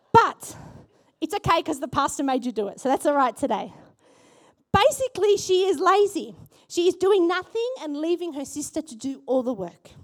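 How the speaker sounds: normal talking voice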